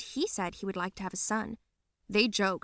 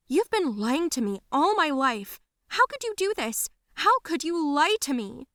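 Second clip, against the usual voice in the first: high, upset and louder; hurt accusation; 7 semitones higher, 5 dB louder than usual. Acted. Daughter accusing mom of lying, hurt and angry. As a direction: accuse her, voice rising in outrage.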